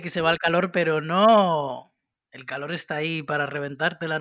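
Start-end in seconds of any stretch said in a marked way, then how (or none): 0.7-1.9 slow, loud, stretched "pero no"; drawn-out no. Acted. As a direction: drag out "no" like a cruel punchline. Punchline after a false hope. ("pero no" lento, alargado y más fuerte)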